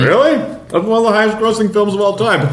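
high incredulous gasp; mock surprise. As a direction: act shocked, pitch up. Mock surprised studio exec.

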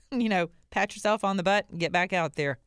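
ordinary talk, nothing marked

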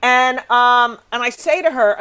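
usual speaking voice

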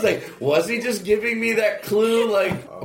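normal talking voice

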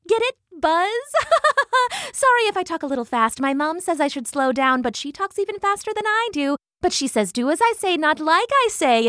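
high-pitched, speedy excited patter; chattering hummingbird. A narrator's reading. Hummingbird greeting the bees and wasps, speedy chatter. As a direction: rattle on giddily in a high voice, giggling. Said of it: "the hummingbird's speedy patter".

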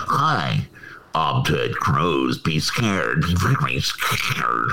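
nasal voice, nose pinched, slow and low; Ted Cruz impression. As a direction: hold your nose, talk slow and low. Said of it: "Ted Cruz impression / you just hold your nose".

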